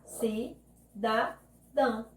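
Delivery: "Cidadão" very slowly, syllable by syllable; separando sílabas. Shown as a demonstration. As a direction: clear pauses inside "Cidadão": Ci, da, dão. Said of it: "e separar". ("Cidadão" sílaba por sílaba, com pausas)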